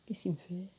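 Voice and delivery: hushed whisper, barely voiced; whispering on the phone. Real, not acted. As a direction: keep it down so nobody hears. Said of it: "je peux pas parler fort".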